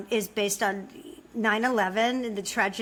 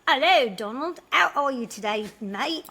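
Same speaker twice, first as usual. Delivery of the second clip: higher-pitched, badly faked Cockney greeting; mock Cockney; 4 semitones higher than usual. Co-host greets Donald, playful bad Cockney accent. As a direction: greet him like a hammy Cockney. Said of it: "so kinda Cockney".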